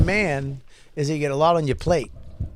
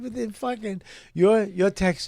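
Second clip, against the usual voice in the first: ordinary talk, nothing marked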